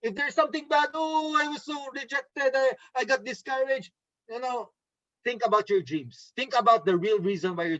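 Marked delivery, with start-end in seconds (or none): 0.9-3.9 higher-pitched melodramatic mock lament. Trainer mimicking rejected team members, melodramatic. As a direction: whine it like an overdone sob story. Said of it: "Do not be so melodramatic".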